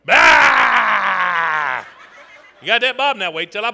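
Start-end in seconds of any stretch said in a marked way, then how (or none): 0.1-1.8 long loud bellowed bah; huge angry-mob yell; mob leader's roar. Shown as a demonstration. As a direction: leap in with a drawn-out roar. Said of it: "and go, 'Bah!'".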